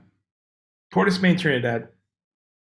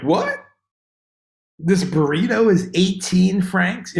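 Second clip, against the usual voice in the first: high-pitched shocked customer; astonished, pitch jumping up; incredulous; 5 semitones higher, 3 dB louder, slower than usual. Acted. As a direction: balk at the price in disbelief. Acting out shocked tourist at prices.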